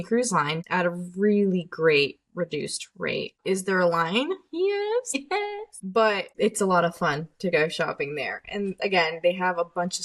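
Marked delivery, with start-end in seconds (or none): 4.5-5.8 squeaky high yes; high-pitched yes. Real, not acted. Answering own question, very high.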